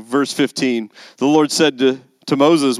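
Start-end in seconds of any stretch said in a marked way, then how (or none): none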